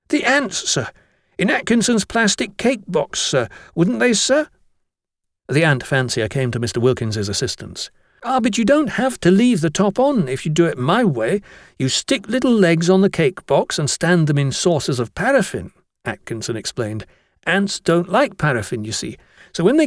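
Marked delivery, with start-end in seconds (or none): 0.1-4.5 put-on high, earnest little boy's voice; eager young schoolboy. Boy asks the master about the ants, high and eager. 8.2-15.7 put-on higher boyish voice, keen to explain; know-it-all schoolboy. Atkinson explains his paraffin trick to the master, keen boyish voice.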